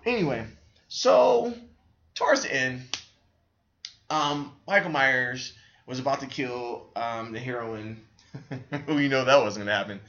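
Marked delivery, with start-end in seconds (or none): none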